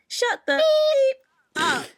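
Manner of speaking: high, slow, loud shout from the chest; shouted with vim. Retold or imitated. Rude girl telling narrator to shut up, loud shout. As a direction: spit it out from the chest. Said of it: "With vim from her chest".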